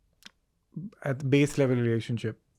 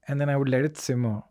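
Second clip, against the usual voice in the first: ordinary delivery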